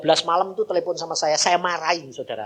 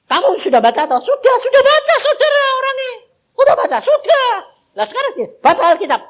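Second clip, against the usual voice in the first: much higher, loud, mocking with laughter; laughing mockery; 11 dB louder than usual. Pastor mocking caller's excuse, laughing and much higher. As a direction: scoff at the excuse while laughing.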